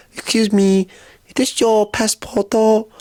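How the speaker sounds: high, careful imitation of a kind stranger; sweet Japanese woman. Kind Japanese woman returning a lost passport, sweet high imitation. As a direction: pitch it high and gentle, stretching the question. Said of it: "so sweet, so kind they are".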